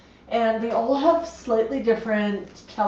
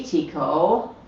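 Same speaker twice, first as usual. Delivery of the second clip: ordinary talk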